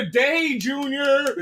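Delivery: high, loud, hammed-up order; mock-yell at a dawdling worker; impatient shout. Acted boss hurrying slow worker.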